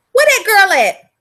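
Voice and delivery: slow, loud and high, calling across the room. Real, not acted. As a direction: call out for her like she's lost in the house. Calling out for the viewer.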